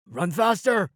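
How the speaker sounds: high, loud urgent call; shouted urging. A narrator's reading. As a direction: shout them onward. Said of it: "Eric shouted".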